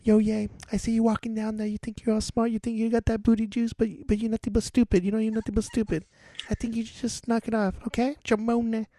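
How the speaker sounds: high-pitched celebrity impression. Host imagining what M J would say, high impression. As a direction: put on a high, light singer's speaking voice. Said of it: "what if M J was here".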